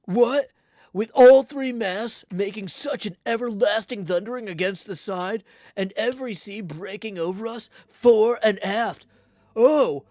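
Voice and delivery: put-on loud, high, indignant old sea captain; an old captain thundering at the cabin, outraged; Bildad's retort. Old Bildad scolding everyone in the cabin, loud and indignant.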